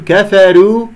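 كفروا with a light, fronted r; thin raa. Shown as a demonstration. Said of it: "أرقق الراء".

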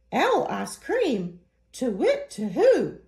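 a frightened owl hooting its way out; hoo-hooing owl in a panic; alarmed owl hoot. Acted.

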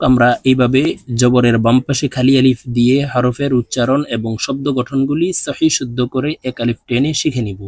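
ordinary talk

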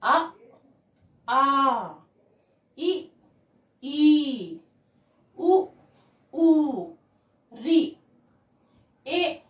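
each vowel alone, firmly voiced with pauses; careful, spaced-out teacher's recitation; pronunciation drill. Shown as a demonstration. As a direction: model the Hindi vowels one at a time. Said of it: "एक बार फिर से पढ़ती हूँ".

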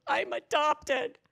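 high, loud, drawn-out imitation of a boy; boy's outburst. Troubled boy confessing to retreat leader, mock outburst. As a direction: blurt it out as an upset kid, hammed up.

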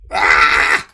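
short unvoiced cry of fright; sharp scared yelp. Real, not acted. Gamer startled by the monster, sharp yelp. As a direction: yelp as the monster grabs you.

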